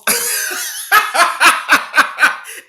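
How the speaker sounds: loud high burst of laughter. Real, not acted. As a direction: crack up at the joke. Laughs at Mahomes point.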